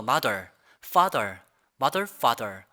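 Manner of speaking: mother and father with tongue curled hard on R; overcurled R. Shown as a demonstration. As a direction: overdo the final R in mother, father. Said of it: "R 발음을 이렇게 생각해요". (mother, father를 혀를 잔뜩 꼰 R로)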